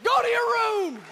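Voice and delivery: high, loud barked command; angry order. Retold or imitated. Parent shouting the teenager off to her room, loud.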